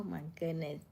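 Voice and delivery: usual speaking voice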